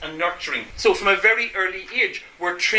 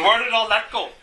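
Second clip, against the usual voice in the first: ordinary talk, nothing marked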